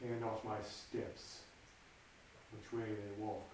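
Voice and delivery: low, soft brooding murmur to himself; hushed soliloquy. Acted. Macbeth alone before the murder, low and hushed.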